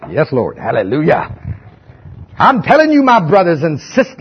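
hammed-up southern revival preacher; southern preaching voice. Shown as a demonstration. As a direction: preach it like a fired-up southern pastor. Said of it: "I gotta get my southern preaching voice on".

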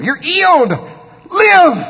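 put-on high, loud English-accented command; mock English accent. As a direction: shout it like an English healer. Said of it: "That's how he said healed".

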